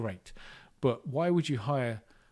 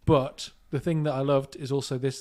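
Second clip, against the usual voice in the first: ordinary talk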